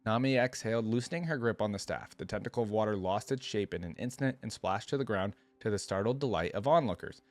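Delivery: usual speaking voice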